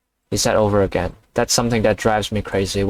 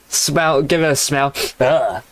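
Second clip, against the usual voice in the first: higher-pitched sniff test ending in "ugh"; mock disgust; 4 semitones higher than usual. Reader imagining teacher handling paper like a turd, mock disgust. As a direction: act out recoiling from a stink. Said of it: "Like that".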